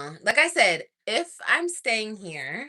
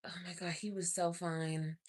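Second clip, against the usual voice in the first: whisper; 3 semitones lower, 12 dB quieter than usual. Real, not acted. Gushing about the barber.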